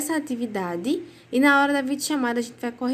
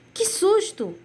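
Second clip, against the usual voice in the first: startled exclamation; 8 semitones higher, slower than usual. Shown as a demonstration. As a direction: say it like something just jumped out. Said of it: "como se tivesse realmente um tom de susto".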